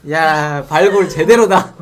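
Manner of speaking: normal talking voice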